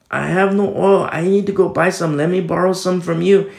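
frantic plea. Acted. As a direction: beg loudly as if your lamp is dying.

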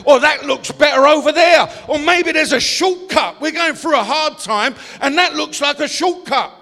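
loud, driving sermon climax; preaching at full force. Acted. Pastor warning the congregation about distraction, preaching loud. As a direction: hammer each line home to the congregation.

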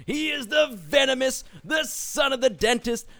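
ring announcer. Acted. Host introducing the guest as a wrestler, loud announcer style. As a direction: bellow the titles like a ring announcer.

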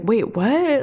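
puzzled, lightly acted double take; confused. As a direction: imitate a baffled kid questioning. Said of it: "he's so confused".

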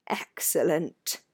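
slow, whispery groan of pleasure; Ron's groan of longing. A narrator's reading. Ron sees the feast appear, groaning with longing. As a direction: draw it out hungrily on the breath. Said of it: "with a kind of groan of longing".

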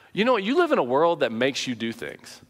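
usual speaking voice

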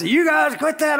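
high shouted scolding; dad yelling. Retold or imitated. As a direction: yell at the kids to knock it off. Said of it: "he'd yell at us".

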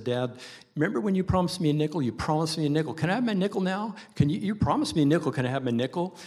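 put-on high, fast pleading kid voice; whiny little girl. Preacher voicing a girl nagging her dad. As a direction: beg like a child who wants her nickel. Child begging father in story.

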